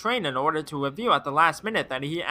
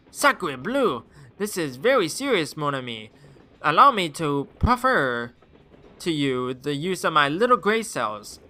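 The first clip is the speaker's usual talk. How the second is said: put-on French accent. French detective figure.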